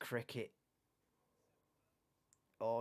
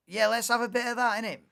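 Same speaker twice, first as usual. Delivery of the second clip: normal talking voice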